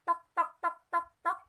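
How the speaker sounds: high, loud mock knocking; voiced knock on a door; knocking sound. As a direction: tap out the knock with the voice.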